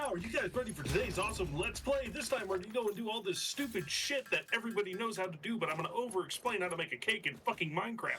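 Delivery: high, fast hyped Let's Play host; clickbait video narrator. Retold or imitated. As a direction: rattle it off like a YouTuber. Mocking Let's Play intro.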